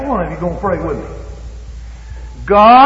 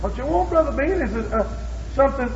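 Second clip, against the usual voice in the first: mock reply; 8 semitones higher, 6 dB quieter than usual. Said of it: "God answering softly".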